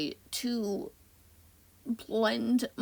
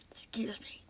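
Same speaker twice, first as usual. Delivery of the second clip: whispered, breathy and soft; 8 dB quieter than usual. Real, not acted.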